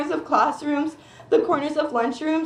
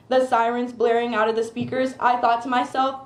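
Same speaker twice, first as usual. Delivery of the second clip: usual speaking voice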